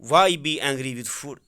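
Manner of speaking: slow and loud, word by word. Shown as a demonstration. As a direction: read it out slowly and clearly. Teacher reads English text aloud. (آہستہ اور بلند آواز میں پڑھنا)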